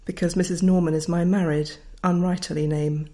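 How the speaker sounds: ordinary delivery, nothing marked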